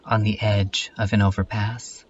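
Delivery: deliberate, projected reading in short phrases; poetic recitation. Acted. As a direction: recite it line by line like a spoken poem. Recites an introspective piece, slowly.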